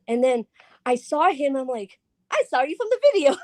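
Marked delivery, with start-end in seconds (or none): 2.3-3.4 high, fast mock squeal; excited recognition. Fan spotting someone from the video, high excited squeal. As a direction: squeak it out quickly like a starstruck fan.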